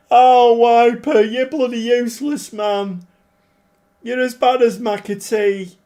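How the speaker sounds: loud, high exasperated yelling at a player. Real, not acted. Manager yelling at his striker Wiper for missing again. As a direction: berate the useless striker, half laughing.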